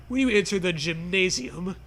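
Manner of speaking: normal talking voice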